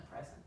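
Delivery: "present" with the weight up front, PREZ-ent; noun "present". Shown as a demonstration. Tutor naming the noun for a student, "present" stressed on PREZ. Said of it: "And so that's a noun".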